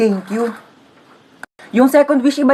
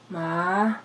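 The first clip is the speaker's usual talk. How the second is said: ordinary delivery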